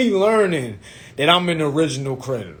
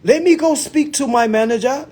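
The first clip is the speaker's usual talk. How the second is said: put-on high-pitched African-accented salesman; imitated African accent; 11 semitones higher, 4 dB louder than usual. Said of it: "That's when the accent came back".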